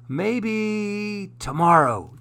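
plain reading voice

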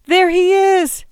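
very high, loud shout above a din; shrill it out over the crowd; piercing, frantic call of recognition; shrill cry. A narrator's reading. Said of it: "Emily shrilled above the din".

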